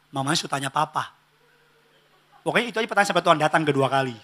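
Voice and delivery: ordinary talk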